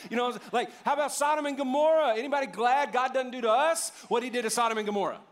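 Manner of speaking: rising to a fervent peak; fiery preaching. Acted. Preaching at full force.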